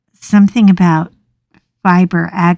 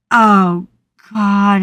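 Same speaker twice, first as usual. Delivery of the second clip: normal talking voice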